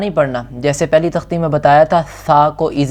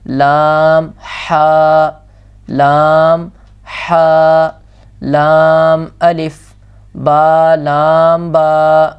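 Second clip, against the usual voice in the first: each letter name held long and clear; slow recitation; 4 dB louder, much slower than usual. Shown as a demonstration. Teacher reciting letter pairs for students to repeat. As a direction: recite slowly and steadily.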